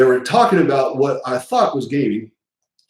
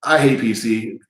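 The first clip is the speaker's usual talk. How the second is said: normal talking voice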